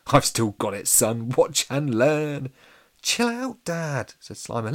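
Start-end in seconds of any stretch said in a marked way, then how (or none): 0.1-2.5 loud, cocky ghost, rising and stretching out; boastful. A narrator's reading. Ghost boasting to his son. 3.0-4.1 high-pitched protest to dad; exasperated child. A narrator's reading. Child telling off his dad.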